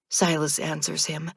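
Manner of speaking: plain reading voice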